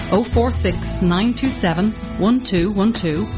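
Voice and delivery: ordinary delivery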